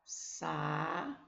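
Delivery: "Saúde" softly sounded out, syllable by syllable; "Saúde" drawn out in separate beats; sa-ú-de, slow and apart; split into syllables. Shown as a demonstration. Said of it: "Vai separar a sílaba de saúde".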